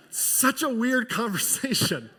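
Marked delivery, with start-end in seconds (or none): none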